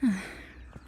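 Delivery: contented sigh. Acted. Receptionist out on a walk with a coworker, contented sigh. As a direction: let out an easy sigh.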